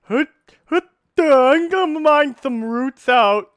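high, loud dopey mock voice, slow; dumb voice. Gamer mocking a slow game character in a dumb voice. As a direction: play a dim-witted grunting miner. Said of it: "It's like".

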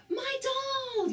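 very high, delighted storybook squeal; happy yell. A narrator's reading. Harry sees his own doll at last, happy high yell. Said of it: "yelled Harry happily".

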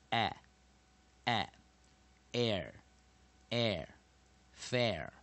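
"a, a, air, air, fair" built up sound by sound; sound building. Shown as a demonstration. Teacher showing students how the word is built, sound by sound. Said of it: "add short vowel E".

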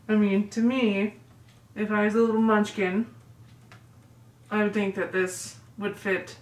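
muffled behind tight mask; talking with mask over mouth; through a face mask. Real, not acted. Seen 0: Woman pulls the small pink mask over her mouth while talking.

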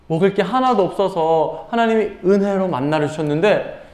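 ordinary talk, nothing marked

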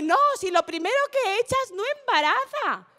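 high-pitched, insistent mock reassurance; mock dismissive excuse. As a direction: imitate someone confidently talking nonsense. Mock quote of lover's excuse.

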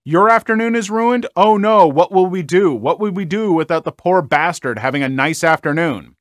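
mock her self-pity with fake alarm; sarcastic mock yell. Mourner sarcastically mocking the intruder's ruined afternoon.

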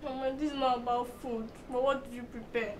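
soft, low, reluctant voice; subdued. Acted. Daughter asks mother about food, quietly subdued. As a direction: give in quietly after crying.

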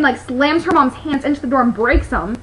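usual speaking voice

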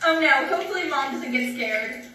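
ordinary talk

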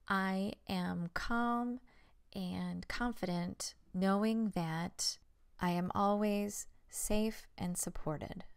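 slow, deliberate affirmation, phrase by phrase; very slow, spaced-out delivery; measured affirmation. Shown as a demonstration. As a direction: recite it slowly as a resolve to repeat. Models an example resolve statement.

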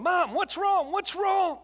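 put-on high, loud panicked shout of a scared boy; frantic yelling. Boy running through the farmhouse to his crying mother, shouting in alarm. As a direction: call out desperately through the house. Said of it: "just yelling".

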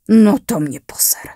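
unvoiced whispered curse; relieved whisper to himself; muttered relief. A narrator's reading. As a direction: breathe it out under your breath. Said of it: "ulevil si Petr".

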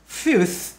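"Fifth" ending on a breathy TH; keep final th. Shown as a demonstration. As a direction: say "Fifth" without dropping the final sound. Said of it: "các bạn đừng có để mất cái âm cuối". (giữ rõ âm cuối TH trong "Fifth")

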